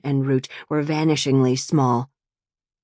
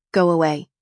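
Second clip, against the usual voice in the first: sharp command; 2 semitones higher, 4 dB louder than usual. A narrator's reading. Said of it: "commanded another voice, ... sharp and rigid".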